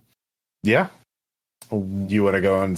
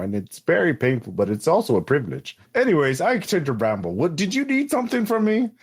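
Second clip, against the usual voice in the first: rambling clerk in a put-on accent; Hunby. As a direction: chatter on as a helpful little clerk. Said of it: "having a moment with this accent".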